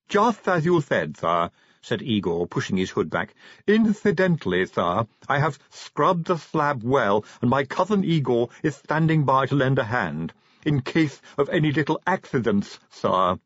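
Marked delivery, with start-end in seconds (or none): 0.1-1.5 slow, high lisping servant; lisping henchman. A narrator's reading. Igor voiced with a lisp. 3.7-13.4 lisping henchman. A narrator's reading.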